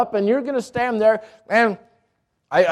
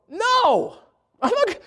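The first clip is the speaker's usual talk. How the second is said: high, alarmed no; frightened cry of refusal; panicked refusal; 11 semitones higher, much slower than usual. Retold or imitated. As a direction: recoil in mock terror. Refusing to let go of rope.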